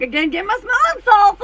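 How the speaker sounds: high, loud theatrical swoon; hammed-up shriek of disbelief; mock swoon. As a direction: ham up fainting from shock. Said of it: "I was like".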